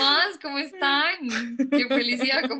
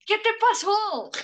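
retold shout; 11 semitones higher than usual. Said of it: "todas gritamos".

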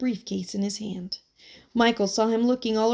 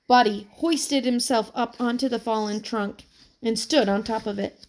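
plain reading voice

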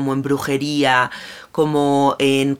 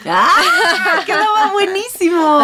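high laughing exclamation; laughing delight; 5 dB louder than usual. Real, not acted. Delighted at name pun.

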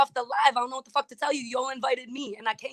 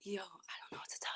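whisper; 4 semitones lower, 24 dB quieter than usual. Real, not acted. Whispered reply in argument.

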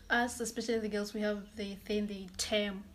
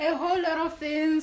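laughing; 3 semitones higher, 5 dB louder than usual. Real, not acted. Host and guest laughing together, loud. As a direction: laugh at the memory. Both laugh about month of love.

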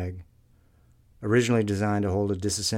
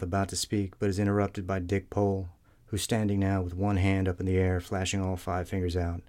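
ordinary delivery, nothing marked

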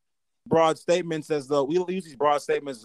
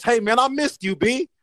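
excited greeting; 8 semitones higher, 4 dB louder than usual. Real, not acted. Greeting cohost warmly.

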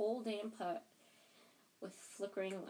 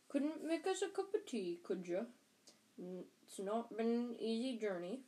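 gruff-mannered giant, casual; 3 semitones higher than usual. A narrator's reading. Giant asks the family for tea, casual giant voice. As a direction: play an unbothered giant making himself at home.

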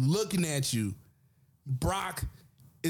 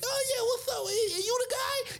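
very high, light Tyson voice; high-voiced boxer greeting; Mike Tyson impression. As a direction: squeak it out friendly but menacing. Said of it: "he come through like".

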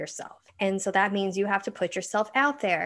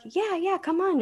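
eager performers; 10 semitones higher than usual. Retold or imitated. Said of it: "they're like".

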